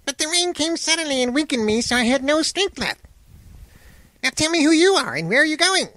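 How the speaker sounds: small voice held very high; crackly fire man. Acted. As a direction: chatter high as a little flame turned man. Fire man explains himself.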